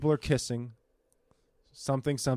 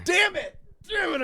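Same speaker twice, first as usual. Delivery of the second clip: mock outrage; 4 dB quieter than usual. Sudden realization, mock outrage.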